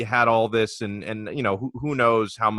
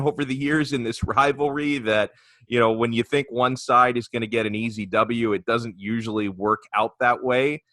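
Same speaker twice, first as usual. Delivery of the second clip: ordinary talk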